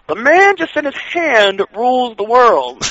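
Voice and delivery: slow, dry, put-on announcer delivery; deadpan. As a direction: say it dead straight, unimpressed. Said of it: "deadpan look on his face".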